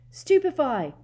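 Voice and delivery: loud high shout. A narrator's reading. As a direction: fire off the spell. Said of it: "He heard Hermione shout".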